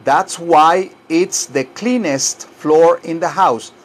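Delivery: slow, deliberate teacher's delivery; slow model sentence. Shown as a demonstration. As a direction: spell it out slowly for repeating. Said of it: "Repitan todo esto".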